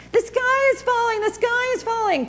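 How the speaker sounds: high, squawky panicked cluck; clucking hen. A narrator's reading. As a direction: cluck it like a frantic chicken. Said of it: "clucked Chicken Licken".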